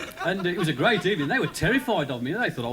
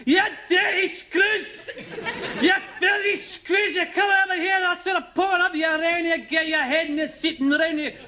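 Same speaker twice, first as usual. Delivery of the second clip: furious Scotsman; 12 semitones higher, 4 dB louder than usual. Retold or imitated. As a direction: rage at the guards like a caged Scot. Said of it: "he was furious".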